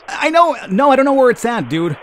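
high, loud comeback; mock exasperated. Player answers a caller asking about his car, mock exasperated.

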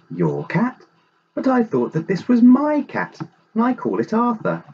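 high, indignant storybook voice; surprised protest. A narrator's reading. Lady Daisy disputes whose cat it is, surprised protest. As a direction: object in disbelief, pitched right up.

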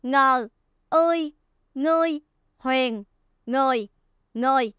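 "ngờ", "ươi", "ngươi", "huyền" spaced out, then "người" twice; spelling aloud. Shown as a demonstration. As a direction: spell it with clear pauses between sounds. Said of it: "Chúng ta sẽ đánh vần là". (đánh vần chậm "ngờ ươi ngươi huyền người")

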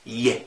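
ي as a clear Y glide; German J as Y. Shown as a demonstration. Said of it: "الحرف ده نطقه". (ي بدل ج)

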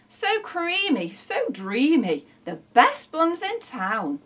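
slow, savouring, swooning praise; gasping delight. A narrator's reading. Neighbours delighted by the food set down, gushing. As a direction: gush over it, drawing out each phrase. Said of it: "They gasped with delight".

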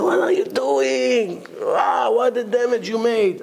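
high, drawn-out mock outrage; aghast reproach. President confronting the lecturer outside, aghast. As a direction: stretch the question out high, scandalized.

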